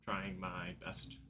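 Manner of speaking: a hint of a gentle, hushed father; tenderly, softly, like a father missing his daughter. DM voices the father's reply.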